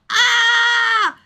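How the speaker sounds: mock flustered high loud cry; a sudden high yelp of alarm; Shuichi's yelp. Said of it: "Shuichi is there being like".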